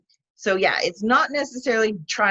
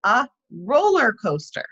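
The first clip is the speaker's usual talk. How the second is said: teacher demo; 3 semitones higher than usual. Shown as a demonstration. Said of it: "and you can say".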